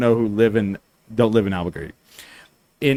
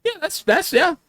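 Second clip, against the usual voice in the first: much higher put-on voice, hesitant; imitating a local. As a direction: squeak it out like a caught local. Imitating people he tells it to.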